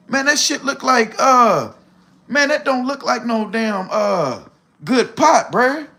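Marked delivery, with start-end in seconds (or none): none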